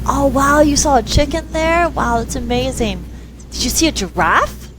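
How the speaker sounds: high, over-the-top wonder; mock amazement. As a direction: feign delight to keep her talking. Playing dumb to the child.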